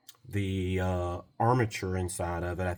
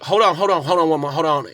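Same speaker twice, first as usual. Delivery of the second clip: higher and louder, rushed interruption; urgent; 7 semitones higher, 10 dB louder than usual. Retold or imitated. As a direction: cut him off, hold on. Customer cutting the call short.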